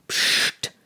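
soft whispered "psst"; hushing. Acted. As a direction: hush your companion quietly. Horse hushes his companion. (leise zischendes Psst)

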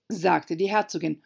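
ordinary delivery

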